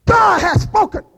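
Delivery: loud, high declaration; shouted proclamation. Real, not acted. Preacher proclaiming to the church, loud. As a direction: proclaim it with force.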